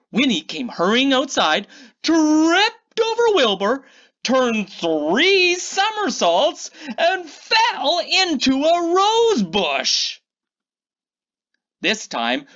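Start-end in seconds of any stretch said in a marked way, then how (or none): 2.0-10.2 dramatic tumble. A narrator's reading. Narrating Winnie's big fall.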